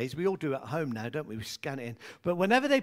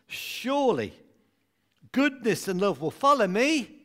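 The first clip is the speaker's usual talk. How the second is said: responsive reading; 2 semitones higher than usual. Acted. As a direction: lead the room line by line. Leads congregation in responsive reading.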